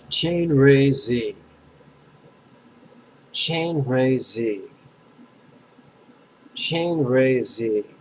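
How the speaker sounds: "Chain ray zieg" very slow, each syllable held; syllable stacking. Shown as a demonstration. Teacher stacks three syllables for students, slow pronunciation demo. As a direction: draw out "Chain ray zieg" evenly, pausing for an echo. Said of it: "Now three syllables".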